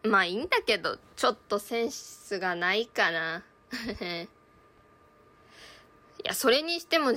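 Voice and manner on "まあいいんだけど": usual speaking voice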